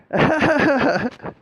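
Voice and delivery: bursts out in a loud high laugh; real loud laugh. Real, not acted. To listeners about demons, a loud high laugh. Laughs at own dark joke.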